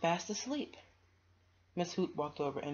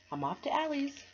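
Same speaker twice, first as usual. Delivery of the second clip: high, louder chirpy goodbye; cheerful little girl; 11 semitones higher, 5 dB louder than usual. Acted. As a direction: skip away happily. Friend leaves cheerfully.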